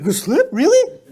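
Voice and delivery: loud, high incredulous outburst; mock-astonished, laughing high squawk; can't handle it. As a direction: act out flabbergasted disbelief, voice leaping up. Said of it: "He couldn't handle it".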